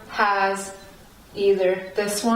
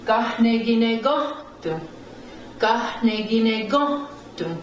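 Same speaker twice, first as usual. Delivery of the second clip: "Gahneginegohtu" twice, goh raised, tu dropped; upstress on "goh". Shown as a demonstration. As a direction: punch goh in each "Gahneginegohtu". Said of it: "up stress goes on the go".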